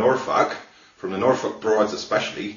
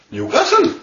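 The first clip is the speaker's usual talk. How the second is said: ordinary delivery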